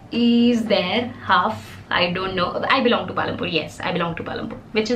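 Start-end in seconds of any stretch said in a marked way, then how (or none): 3.5-4.6 softer and faster, tossed off to herself; soft quick repeat. Shown as a demonstration. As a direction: murmur the repeat quickly, almost under her breath. Repeats her hometown claim softly.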